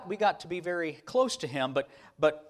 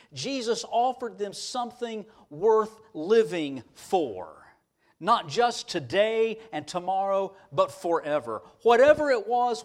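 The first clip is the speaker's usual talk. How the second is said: raised, emphatic preacher's cadence; preaching; 5 semitones higher, 5 dB louder, slower than usual. Real, not acted. Preacher telling church what Jesus gave followers, fervent and emphatic. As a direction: build it phrase on phrase with weighty stresses.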